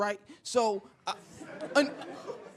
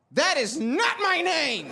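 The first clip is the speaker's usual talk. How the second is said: slow, high, emphatic objection; indignant correction; 6 semitones higher, slower than usual. Acted. Embarrassed teen answering the stranger's insult, slow indignant correction. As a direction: correct him slowly and primly, pitched up.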